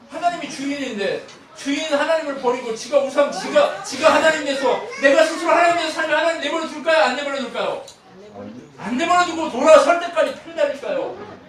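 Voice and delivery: loud, high, driving sermon; impassioned preaching. Acted. Preacher warning the congregation, loud and high. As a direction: hammer the point home with rising heat.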